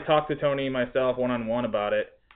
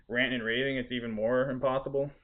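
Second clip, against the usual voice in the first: usual speaking voice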